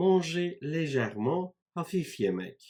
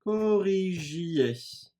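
very slow, higher "Corrigiez"; slow syllabic model; 6 semitones higher, much slower than usual. Shown as a demonstration. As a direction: draw out each syllable of "Corrigiez". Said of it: "aynen imparfait'deki gibi çekimleyeceğim".

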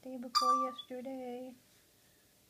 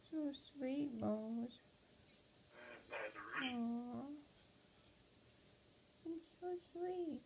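higher, softer cooing; soft pet talk; 4 semitones higher, 8 dB quieter, slower than usual. Real, not acted. Owner cooing over her parrot, soft high pet talk. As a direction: murmur it tenderly to the bird.

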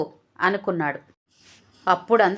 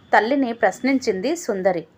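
ordinary delivery

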